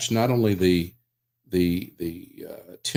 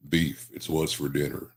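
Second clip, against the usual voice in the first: Sam Elliott voice; 5 semitones lower, 6 dB quieter than usual. Shown as a demonstration.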